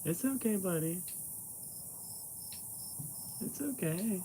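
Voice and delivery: calm the nervous cat gently; hushed, high soothing to a skittish cat; soft reassuring pet-talk. Real, not acted. Soothing the cat being petted.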